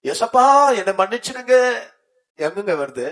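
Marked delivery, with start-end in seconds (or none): 0.0-1.5 high, loud climactic sermon voice; fiery preaching. Acted. Pastor tells the church of surrender, preaching loud and high. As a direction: preach it out to the congregation at full force. Preacher building to full force.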